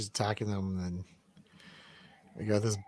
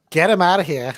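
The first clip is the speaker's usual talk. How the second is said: put-on New York accent; 5 semitones higher, 3 dB louder than usual. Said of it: "That's my New York accent".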